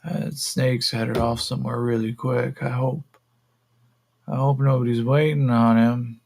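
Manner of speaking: loose, woozy character voice; Yakeem. Acted. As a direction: play him spaced-out and unbothered. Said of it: "And Yakeem just kind of".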